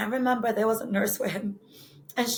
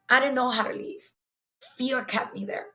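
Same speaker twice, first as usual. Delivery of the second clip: usual speaking voice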